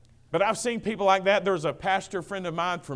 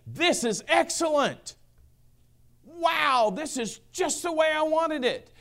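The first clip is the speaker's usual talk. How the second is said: higher, delighted voice of God admiring his work; thrilled delight; 11 semitones higher, slower than usual. Retold or imitated. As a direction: beam with pride, it's just what you wanted.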